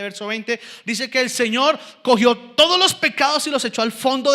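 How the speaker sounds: emphatic pulpit delivery; forceful preaching. Real, not acted. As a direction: proclaim it to the whole congregation.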